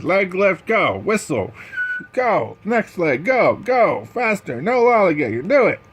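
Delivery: high, loud coach shouting orders; barking drill commands. Acted. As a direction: drive the team through leg lifts.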